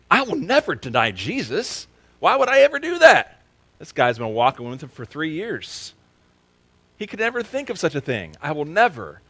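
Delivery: high, loud, scoffing certainty; mock Peter's cocky disbelief; overconfident Peter. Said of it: "Peter was so confident".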